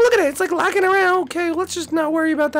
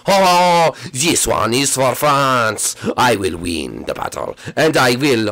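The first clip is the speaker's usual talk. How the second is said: low, slow battle vow; deep warrior voice; slower than usual. Acted. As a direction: swear it like a grim knight. French Koopa battle cry.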